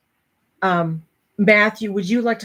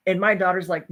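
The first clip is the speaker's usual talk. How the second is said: ordinary delivery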